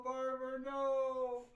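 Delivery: high, laughing groan of disbelief; laugh-cry at the answer; pained laughing protest; laughing dismay. Real, not acted.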